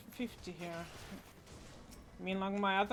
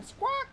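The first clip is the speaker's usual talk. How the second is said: high, loud spoken squawk; mock parrot cry; 4 dB louder than usual. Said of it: "I'm doing a parrot voice acting now".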